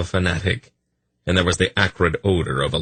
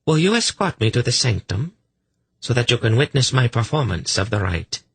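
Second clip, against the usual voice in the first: higher, inviting voice; eager priestess; 6 semitones higher than usual. A narrator's reading. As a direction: invite an admirer to watch, eagerly. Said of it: "with a sudden dreadful eagerness".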